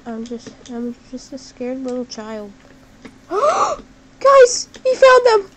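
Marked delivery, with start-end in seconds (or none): 3.0-3.8 high loud gasp of discovery; sharp excited gasp. Real, not acted. Spotting the foxes. 4.2-5.5 loud, much higher thrilled announcement; excited shout. Real, not acted. Excitedly finding the foxes.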